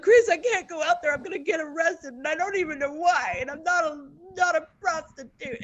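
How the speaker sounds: high, flustered pleading; retold distressed objection; panicked protest. Retold protest against going outside.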